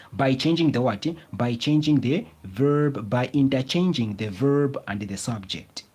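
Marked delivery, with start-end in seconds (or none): none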